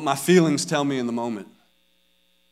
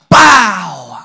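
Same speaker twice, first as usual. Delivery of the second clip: sudden loud "bow" for a hit; smack sound effect; 2 semitones higher, 9 dB louder than usual. Retold or imitated. Dad acting out the swat he gave, loud smack sound. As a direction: burst it out like an impact.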